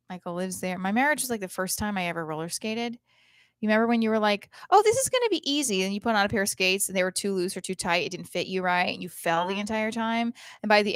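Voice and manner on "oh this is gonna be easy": high, loud mock-cheery boast; naive confidence. First-time skater before falling, naive confidence. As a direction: chirp it with overblown confidence.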